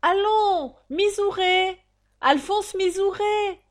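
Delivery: high, loud, insistent summons; impatient crier calling out a name. Acted. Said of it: "l'aboyeur éleva la voix".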